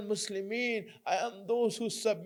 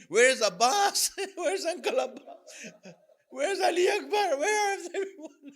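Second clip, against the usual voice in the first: high, tearful pleading questions; anguished son; 7 semitones higher, 4 dB louder than usual. Acted. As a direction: cry out for missing loved ones, rising higher. Grieving son asks after slain relatives.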